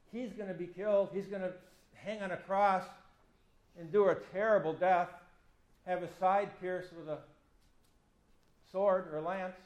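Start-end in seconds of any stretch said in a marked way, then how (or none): none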